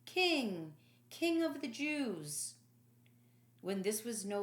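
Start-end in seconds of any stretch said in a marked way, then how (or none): 0.0-2.5 mocking, drawn-out slow taunt of King; jeering soldiers. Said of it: "They jeered and mocked".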